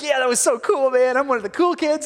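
hammed-up pleased-with-himself excitement; excited bragging. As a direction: play an excited Haman showing off. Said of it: "He's all excited".

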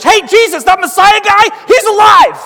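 high, loud acted shout; excited news-breaking. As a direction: burst out with astonishing news. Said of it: "someone's saying in your own language".

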